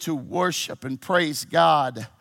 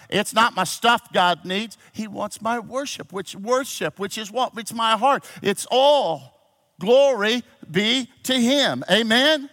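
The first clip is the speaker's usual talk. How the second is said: preaching building to praise; 7 semitones higher, 5 dB louder than usual. Real, not acted. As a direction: voice climbing high and louder.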